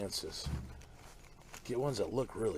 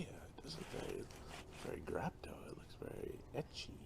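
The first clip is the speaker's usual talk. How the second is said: whisper; 5 semitones lower, 9 dB quieter than usual. Real, not acted. Muttering to herself over plant.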